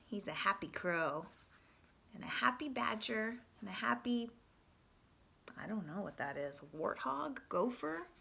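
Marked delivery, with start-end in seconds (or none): none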